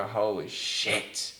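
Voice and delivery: retold amazement. Designer looking at old work, drawn-out amazement. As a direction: stretch both words out, softly appalled.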